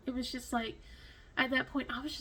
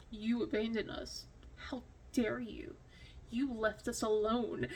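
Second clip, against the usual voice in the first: sulky accusing cats; 7 dB quieter than usual. Pretend. The neglected cats glaring at their owner, soft hushed reproach. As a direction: hiss the guilt trip quietly. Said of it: "just constant, like".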